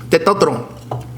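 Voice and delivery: Testosterone as "teh-tah-tron" in a mock Spanish accent. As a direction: put on a Spanish accent, dropping the s sounds. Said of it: "Why do I have a Spanish accent".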